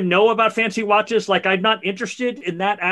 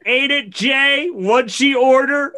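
louder, higher, slow, delighted recitation; higher, louder, gleeful quoting of the lyric; 6 semitones higher, 6 dB louder, slower than usual. Real, not acted. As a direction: savor the line with glee. Gleefully quoting favorite line.

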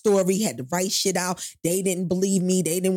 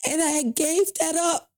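high, strained, holding back tears; emotional, voice breaking; 6 semitones higher than usual. Real, not acted. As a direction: push through rising tears. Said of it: "forgive me for getting so emotional".

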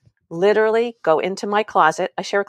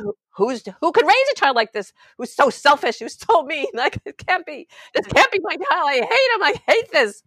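put-on higher, exasperated rant about the child; exasperated venting; 9 semitones higher than usual. Mom alone in the closet, venting in an exasperated raised voice. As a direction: let out the frustration you'd never say to the kid.

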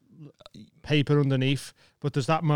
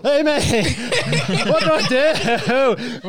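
higher, laughing cry for help; laughing panic; 7 semitones higher, 4 dB louder, much slower than usual. Retold or imitated. Stuck on tiptoes under a spider, laughing helplessly. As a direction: laugh through a mock panic.

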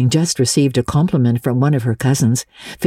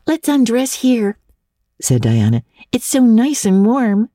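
put-on a higher, friendly girl voice; lightly voiced girl Diana; 8 semitones higher than usual. A narrator's reading. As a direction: pass on a compliment sweetly.

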